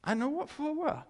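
high, pointed rebuke; name them plainly; Jesus rebuking. Retold or imitated. Jesus to the hypocrites, high pointed rebuke. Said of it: "He said".

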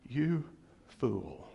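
a low, stern rebuke, dropping on "fool"; God's stern rebuke. Retold or imitated. Preacher voicing God scolding the rich man, stern rebuke. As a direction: scold him like a disappointed God. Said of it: "or God said to him".

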